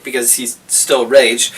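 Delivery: normal talking voice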